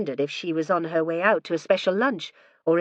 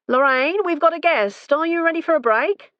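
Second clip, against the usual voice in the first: higher and louder call up the stairs; calling upstairs; 11 semitones higher, 5 dB louder than usual. A narrator's reading. Husband calling up the stairs to his wife, loud and high. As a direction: call out to someone on another floor.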